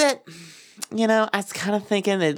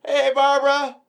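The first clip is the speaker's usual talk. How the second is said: high holler across the street; mock neighborly call; 6 semitones higher, 3 dB louder than usual. Neighbor hailing Barbara, high jolly mock shout. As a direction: call out the curse like a friendly hello. Acting a neighbor calling out greetings.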